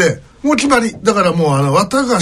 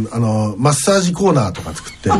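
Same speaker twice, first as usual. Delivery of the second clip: ordinary talk, nothing marked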